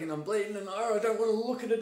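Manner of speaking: put-on hurt boy too scared to look at his knee; mock scared kid. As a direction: play the frightened boy with a bleeding knee. Retelling a scared hurt child.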